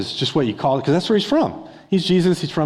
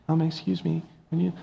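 put-on shy child; 5 semitones higher, 6 dB quieter, faster than usual. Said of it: "they go up and they're like".

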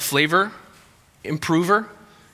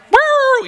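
high, loud "woo" like a siren; imitated police siren; 9 dB louder than usual.

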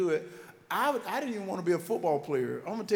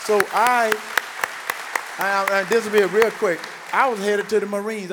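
much louder, higher, preaching to the crowd; booming excited; 4 semitones higher, 8 dB louder than usual. Real, not acted. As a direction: belt it out like a preacher reaching his point. Building to the big reveal.

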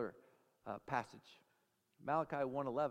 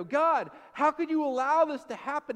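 high, loud cry to God; loud mock accusation; outraged complaint; 9 semitones higher, 12 dB louder than usual. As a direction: demand answers from heaven, acted out. Acted complaint to God, raised voice.

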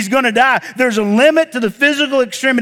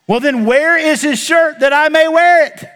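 a higher, louder kingly demand; the king asks eagerly, voice raised; king's demand; 6 semitones higher, 4 dB louder than usual. Acted.

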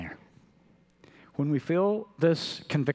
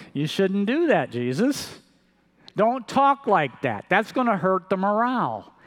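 raised, higher, louder protest as Peter; 7 semitones higher, 4 dB louder than usual. Acted. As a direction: urge Jesus not to go, raised and insistent. Peter protesting to Jesus, acted.

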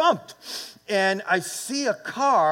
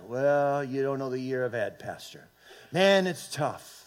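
mock discouraged; 6 semitones lower, 4 dB quieter than usual. Voices a discouraged church member answering.

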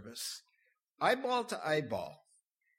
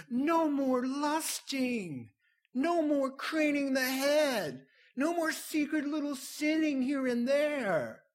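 whining flesh; 10 semitones higher than usual. Pretend. Pastor mocking the flesh's protest to the congregation, high whiny wail. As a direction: wail like the sinful nature losing its fun.

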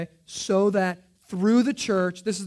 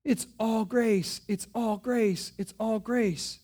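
slow repeated refrain; 3 semitones higher, slower than usual. Acted. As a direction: savour each word slowly. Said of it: "you're so redundant".

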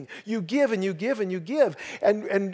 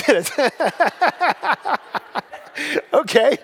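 chuckling through the words; amused laugh at his own slip; 8 semitones higher, 4 dB louder than usual. Real, not acted. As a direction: laugh it off, embarrassed. Laughs at own awkward phrasing.

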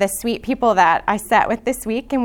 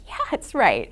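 whispered reassurance; 3 semitones lower than usual. Retold or imitated. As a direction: whisper back the answer gently. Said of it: "I was like".

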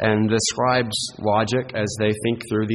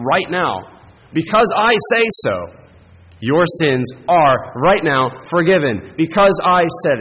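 louder, higher, commanding pronouncement; Jesus declaring with authority; 5 semitones higher, 6 dB louder than usual. Retold or imitated. Preacher voicing Jesus forgiving the paralysed man, a forceful commanding declaration. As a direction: declare it as if your word settles it. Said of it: "the way he says it".

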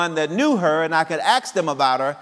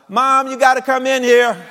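calling out; 9 semitones higher, 7 dB louder than usual. Retold or imitated. Daughter calling her mother in.

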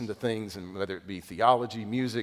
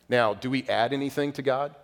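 ordinary talk, nothing marked

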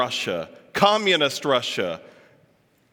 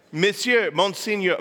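higher, testing French pronunciation; attempted French; 6 semitones higher than usual. Shown as a demonstration. Speaker hunting for the right word, trial French pronunciation. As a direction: try out the French title aloud. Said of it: "how do you say it?".

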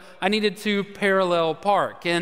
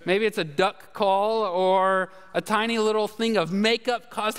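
ordinary delivery

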